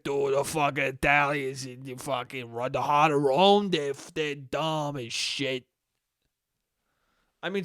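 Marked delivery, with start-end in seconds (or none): 0.0-5.6 low put-on dad voice; mock dad, pitched down; dad impression. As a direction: grumble it like a dismissive dad. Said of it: "my dad's like".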